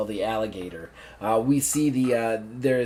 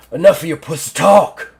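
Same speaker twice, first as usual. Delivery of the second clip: loud, higher bark from a general; angry interruption; 6 semitones higher, 12 dB louder than usual. Acted. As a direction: cut the underling off with sudden force. General cuts his assistant off.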